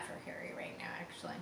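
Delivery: soft, fast whisper. Real, not acted. Reader sharing sympathy for Harry, whisper. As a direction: confide it softly. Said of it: "I'm really feeling bad".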